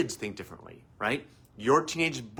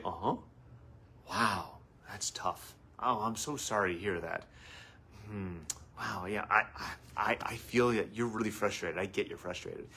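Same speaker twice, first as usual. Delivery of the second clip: soothing validation; 3 semitones lower, 8 dB quieter than usual. Shown as a demonstration. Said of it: "All we had to do is say".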